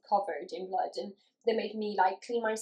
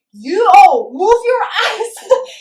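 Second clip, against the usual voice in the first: much louder and higher, drawn-out mock shout; pretend yell; 11 semitones higher, 17 dB louder, much slower than usual. What she wishes she had yelled at the chatting nurses, mock furious. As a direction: blast it at the chatting staff, laughing.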